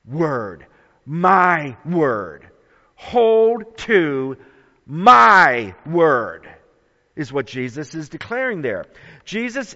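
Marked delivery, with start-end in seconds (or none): 1.1-2.5 "My" punched loud and long; stress on MY. Shown as a demonstration. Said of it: "that emphatic possessive pronoun". 3.0-6.5 loud, deliberate, with "my" hit hardest; stress on MY. Shown as a demonstration. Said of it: "that emphatic possessive pronoun".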